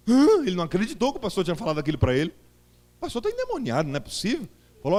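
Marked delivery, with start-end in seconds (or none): 3.0-4.5 a high, shocked cry of disbelief; indignant disbelief. Retold or imitated. Young convert reacting to the pastor's verdict, shocked and indignant. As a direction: squeal it in outraged disbelief. Outraged young preacher's thought.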